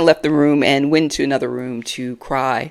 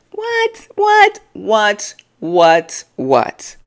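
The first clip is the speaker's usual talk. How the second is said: disbelief; 5 semitones higher, much slower than usual. Real, not acted. Incredulous repeated reaction.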